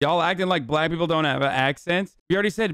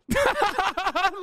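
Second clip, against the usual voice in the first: real high laugh; 14 semitones higher than usual. Real, not acted. Laughing at real racist segue.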